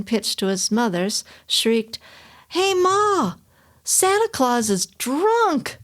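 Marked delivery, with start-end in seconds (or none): 2.5-5.8 put-on high blaring kid's shriek; shrieking child. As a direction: shriek it like a bratty boy. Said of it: "in a blaring voice ... shrieked".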